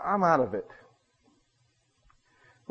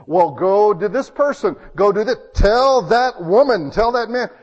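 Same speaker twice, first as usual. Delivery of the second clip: loud, emphatic busybody; imitating a meddler laying down instructions; bossy advice; 4 semitones higher, 6 dB louder than usual. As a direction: hand out orders, raised and insistent. Someone hands out bossy advice.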